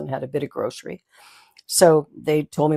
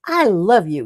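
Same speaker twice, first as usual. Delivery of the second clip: louder, bright mock declaration of love; playful declaration; 3 semitones higher, 7 dB louder than usual. Teasing the stranger over baking soda, louder and playful. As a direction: toss it off like a joke.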